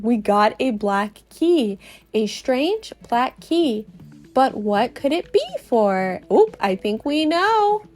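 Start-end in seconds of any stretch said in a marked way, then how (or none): none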